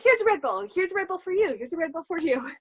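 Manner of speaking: high, excited giveaway-host impression; Oprah. Handing out Red Bulls like prizes, excited and high. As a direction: dole out gifts in a high, gleeful voice. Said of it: "it's like, Oprah".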